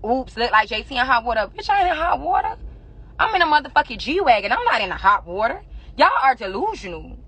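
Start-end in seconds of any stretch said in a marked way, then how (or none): 1.5-4.9 indignant retort. Real, not acted. Snapping back at a blog headline.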